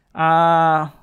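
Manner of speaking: normal talking voice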